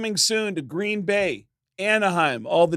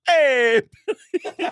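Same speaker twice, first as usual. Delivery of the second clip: mock douchey hey, loud and high; douchey greeting; 7 semitones higher, 3 dB louder, much slower than usual. Mimicking the fan greeting [name], douchey. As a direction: do a douche's drawn-out hello. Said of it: "that specific delivery that you give".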